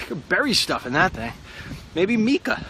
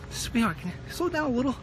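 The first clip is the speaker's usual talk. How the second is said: high, soft and sugary; sweet request; 11 semitones higher, 6 dB quieter than usual. Pretend. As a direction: coax the driver very sweetly. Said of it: "No, I just".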